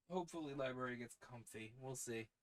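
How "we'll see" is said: normal talking voice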